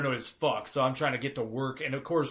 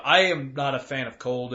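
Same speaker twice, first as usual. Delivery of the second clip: ordinary talk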